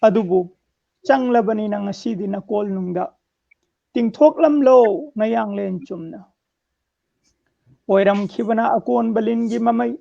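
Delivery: measured declamation in separate phrases; strong line openings, falling cadence; poem recitation. Acted. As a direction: recite verse line by line with pauses. Recites own poem on request.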